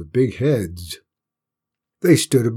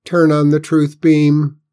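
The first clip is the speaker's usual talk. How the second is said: alien voice; 5 semitones higher, 7 dB louder than usual. A narrator's reading. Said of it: "one of them announced".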